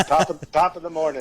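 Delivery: lightly hammed-up Irish accent, egging him on; a hint of a stage Irish greeting; mock Irish accent. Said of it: "I egged you on a little bit".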